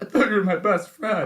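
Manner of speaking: put-on high, loud betrayed-kid wail; mock hurt outburst. Kid accusing a cheating best friend, high mock outburst. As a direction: cry out like a jilted best friend, hammed up. Acted quarrel between childhood friends.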